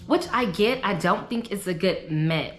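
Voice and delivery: ordinary delivery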